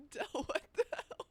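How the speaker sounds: high, loud and laughing; laughing disbelief. Real, not acted. Player reacting to being killed, high laughing shriek.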